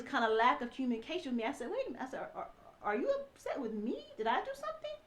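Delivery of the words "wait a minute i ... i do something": climbing higher and higher, bewildered; puzzled high voice. Retold or imitated. Wife baffled, asking her fuming husband what she did wrong. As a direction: ask like you truly have no idea what you did.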